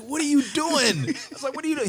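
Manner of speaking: imitating a high, loud, stunned what are you doing; mock outrage at the teammate who blocked him; shocked disbelief. Retold shock at being blocked.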